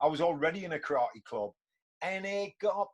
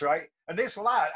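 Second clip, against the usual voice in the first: ordinary talk, nothing marked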